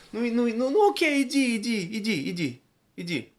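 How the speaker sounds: high go-ahead, falling and fading; exaggerated consent tailing off into dismissal; mock reverse psychology. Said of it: "it's reverse psychology in the most".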